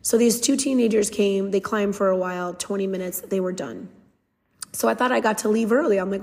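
ordinary talk, nothing marked

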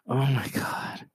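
breathy whispered disbelief; exasperated whisper. Retold or imitated. Fan whispering in despair at Lars talking. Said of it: "it was like".

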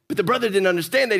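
ordinary talk, nothing marked

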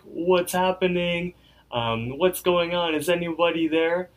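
high, slow worried voice; acted anxious calling. Rigby alone as everyone vanishes, acted anxious calling. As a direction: call out uncertainly, pitched high.

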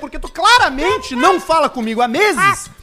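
loud rant. Real, not acted. Ranting at favour seekers.

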